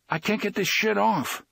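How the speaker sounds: pitched high, strained and urgent; high panicky character voice; panicked prisoner. A narrator's reading. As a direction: voice a trapped man losing his nerve.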